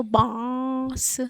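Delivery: बांस with long nasal आ before स; nasalised vowel demo. Shown as a demonstration. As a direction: stretch बांस through mouth and nose. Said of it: "इसको भी उच्चारित करेंगे".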